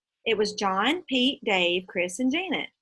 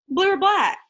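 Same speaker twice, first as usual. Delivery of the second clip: high, loud shopkeeper voice; a cheerful counter lady; chirpy shop lady; 10 semitones higher, 7 dB louder than usual. Acted. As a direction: toss it off like a helpful clerk. Shop lady answers brightly.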